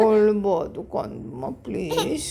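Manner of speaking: gently plead, drawing the words out; soothing plea. Real, not acted. Mother begs crying baby to stop, soothing.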